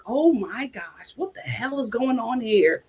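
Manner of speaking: ordinary talk, nothing marked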